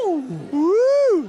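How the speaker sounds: high, loud whoop; cheer. Real, not acted. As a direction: whoop with delight. Cheering about the band.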